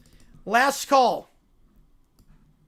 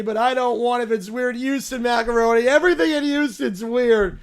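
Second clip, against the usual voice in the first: whiny spoiled royal; 3 semitones higher, 6 dB louder than usual. Acted. Spoiled character complains about the flight, whining. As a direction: whine like a pampered little royal who hates travel.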